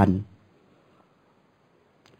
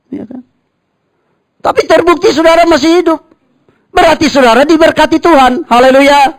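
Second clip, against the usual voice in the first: loud, high, fast preacher's call; preaching; 6 dB louder, faster than usual. Acted. Pastor preaching loudly to the congregation. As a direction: drive it home to the church.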